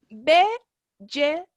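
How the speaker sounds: Vegetables stretched out very slowly, each syllable drawn long; slow motion. Shown as a demonstration. Teacher slowing Vegetables right down for the class, in slow motion. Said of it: "Voy a pronunciarlo yo en cámara lenta".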